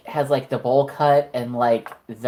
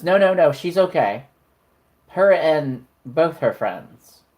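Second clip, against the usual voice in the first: higher, louder insistent host voice, slowing at the end; Uncle Kinky vouching; 4 semitones higher, 5 dB louder, slower than usual. Acted. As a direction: wave them in past the doorman, drawing out the last words.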